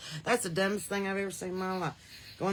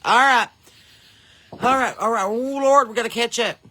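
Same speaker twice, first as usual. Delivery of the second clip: excited; 5 semitones higher, 10 dB louder than usual. Real, not acted. Greeting chat rush.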